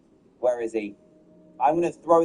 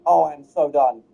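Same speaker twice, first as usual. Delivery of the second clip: ordinary talk, nothing marked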